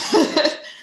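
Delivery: high, breathy laugh through tears; tearful breathy laugh. Real, not acted. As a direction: let a choked laugh escape. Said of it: "I'm feeling emotional".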